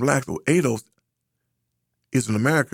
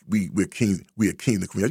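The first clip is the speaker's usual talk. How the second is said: ordinary delivery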